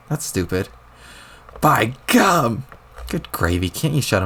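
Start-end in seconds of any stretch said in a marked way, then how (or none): 1.6-2.6 put-on old-timer exclamation. Old grandpa exclaims while powering up.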